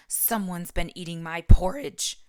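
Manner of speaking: loud booming roar; roaring Papa Bear. A narrator's reading. Papa Bear finds his porridge eaten, roaring loudly. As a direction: bellow it out at full force. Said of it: "roared Papa Bear".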